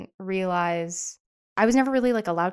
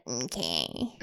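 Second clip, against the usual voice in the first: passive aggressive; 8 semitones lower, 9 dB quieter than usual. Retold or imitated. Retold passive aggressive reply.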